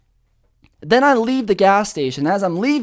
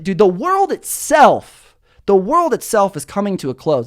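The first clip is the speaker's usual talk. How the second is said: ordinary delivery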